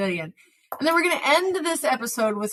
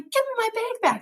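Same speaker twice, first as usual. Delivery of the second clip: high, pitched-up demand to the thief; 12 semitones higher than usual. Retold or imitated. Speaker to shoplifter, a high sharp demand. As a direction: insist on getting the bag back.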